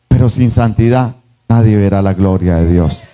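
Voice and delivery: solemn preaching. Acted. Preacher climax line.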